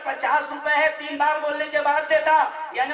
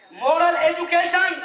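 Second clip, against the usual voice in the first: louder, scoffing repeat of moral education; scornful echo; 2 semitones higher, 3 dB louder than usual. Acted. Preacher mocking the schools' moral education, loud and scornful. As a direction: sneer at the term moral education.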